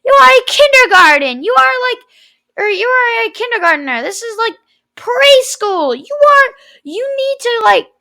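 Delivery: high, loud mock scolding; loud, high-pitched mocking impression of a teacher telling someone off; imitating scolding teacher. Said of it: "yeah, she's like".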